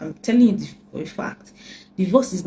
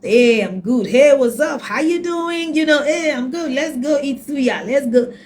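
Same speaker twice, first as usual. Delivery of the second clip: higher, upbeat mock bravado; breezy fake cheer; 7 semitones higher, 4 dB louder than usual. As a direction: play the guy pretending he's fine. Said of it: "They form".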